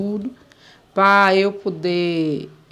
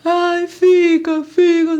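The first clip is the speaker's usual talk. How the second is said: high, slow mock-tearful pleading; imitating her crying; 7 semitones higher, 5 dB louder, much slower than usual. Boss begging the maid to stay, mock crying. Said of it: "A mulher chorando".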